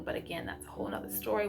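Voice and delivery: quick, breathy throwaway under her breath; hushed aside. Real, not acted. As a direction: drop it to a hushed half-whisper aside. Aside about kids' book counts.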